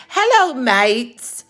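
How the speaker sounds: playful attempted English accent, drawn out. Shown as a demonstration. Showing viewers her practised greeting, amateur English accent. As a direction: try on a chirpy English accent. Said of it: "trying to work on my English accent".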